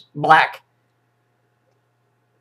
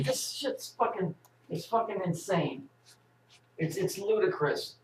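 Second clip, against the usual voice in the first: muttered disbelief; 4 semitones higher, 13 dB quieter than usual. Real, not acted. Muttering disbelief after clip.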